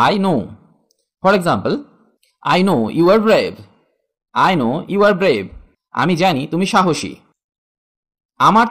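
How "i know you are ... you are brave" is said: very slow, every word laid out; slow model phrase for students; slow teaching demo. Shown as a demonstration. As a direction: spell it out slowly for learners. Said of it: "teacher models English phrase".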